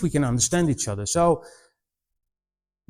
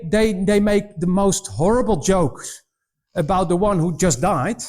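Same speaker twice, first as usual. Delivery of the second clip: normal talking voice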